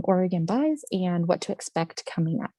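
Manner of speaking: ordinary delivery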